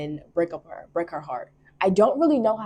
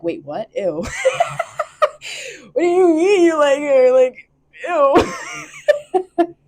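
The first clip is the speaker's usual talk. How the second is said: high, loud, grossed-out "ew" through laughter; mocking disgust; 11 semitones higher, 10 dB louder, slower than usual. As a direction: sneer at him like a disgusted teen. Friends mock him for loving her.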